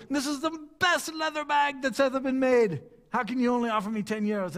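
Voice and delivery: high, indignant sales pitch; excited haggler. Retold or imitated. Merchant defending his leather bag's price, excited and indignant. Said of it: "He gets so excited".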